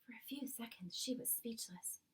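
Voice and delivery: plain reading voice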